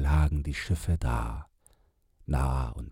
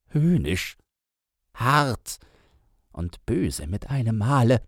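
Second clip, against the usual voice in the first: agitated storyteller man; 9 semitones higher, 5 dB louder than usual. Acted. Man tells his story.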